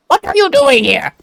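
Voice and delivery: high, sneering alien villain; Frieza voice. Acted. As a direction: ask it like a smug space tyrant. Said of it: "Frieza voice is more like".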